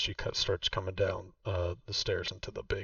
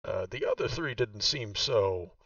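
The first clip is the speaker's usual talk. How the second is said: [name]'s voice; 2 semitones higher, 4 dB louder than usual. Acted. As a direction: play the proud host showing her old home. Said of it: "and she says".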